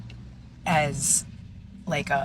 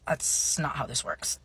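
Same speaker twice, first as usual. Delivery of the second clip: quiet rebuttal; 5 dB quieter than usual. Retold or imitated. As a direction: say it quietly after clearing the throat. Deadpan first reply to grandparents.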